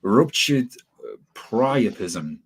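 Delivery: "priapism" said very slowly, syllable by syllable; careful pronunciation. Real, not acted. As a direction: sound out "ruptured" and "priapism" carefully. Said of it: "gonna have trouble pronouncing this one".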